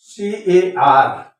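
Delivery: C-A-R spelled slowly, each letter apart; "सीएआर" read as three separate letters, slowly. Shown as a demonstration. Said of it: "आप देख रहे हैं सीएआर".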